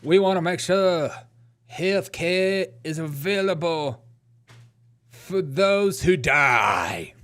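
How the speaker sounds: slow, drawn-out imitation; slow mock echo. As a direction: stretch each word as if quoting her. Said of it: "she wants it to be on repeat".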